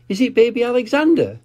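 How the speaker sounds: put-on high, fast eager little-girl guess; Peppa guessing. Peppa eagerly guessing at the photo, high and quick. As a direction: blurt out a guess. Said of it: "she asked".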